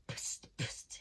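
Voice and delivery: unvoiced, spat-out psst psst; spitting psst. A narrator's reading. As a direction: spit the sounds out in a whisper. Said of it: "he spat".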